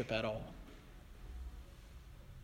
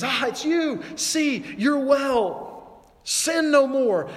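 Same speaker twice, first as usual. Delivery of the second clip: higher, very slow and drawn out; Jesus speaking gently; 9 semitones higher, 4 dB louder, much slower than usual. Acted. Jesus finding the healed man in the temple, higher and very slow. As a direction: stretch each word like a tender warning. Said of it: "Jesus approaches the man and says".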